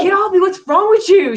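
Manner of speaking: high, loud outraged yell; startled, loud protest; mock yell. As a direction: shout it like he just got bitten. Man retells being bitten.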